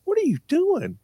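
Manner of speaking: high, sharp mom voice; a mother catching her kid red-handed; mom's voice. Retold or imitated. Mom scolding her son at the gas pump, high and sharp.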